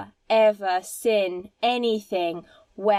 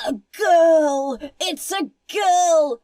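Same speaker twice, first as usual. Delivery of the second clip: put-on higher, louder, gasping "a girl!"; mock astonished shout; 5 semitones higher, 5 dB louder, slower than usual. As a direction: announce it like a shocking discovery. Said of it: "people being like".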